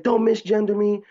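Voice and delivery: very high mock-offended voice; mock screaming. Trolling the crowd after being called sir, mock-offended squeal. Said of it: "I started screaming".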